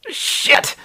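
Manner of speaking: high-pitched yelp, held back in volume; acted yell. Hugh yelling at his reflection in the mirror, mock yell. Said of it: "and yells".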